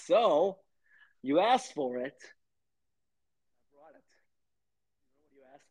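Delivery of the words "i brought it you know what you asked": slow whispered reveal; dramatic whisper. Pretend. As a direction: breathe it out slowly.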